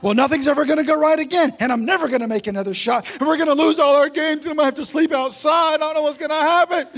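fast, rising panicked rant; panicking kid. Retold or imitated. Kid's inner panic after one missed shot, fast and loud. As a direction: spiral into a kid's doom spiral. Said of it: "go into a tailspin about".

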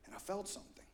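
hushed sermon aside; whispered. Acted. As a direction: whisper the fear like a confession.

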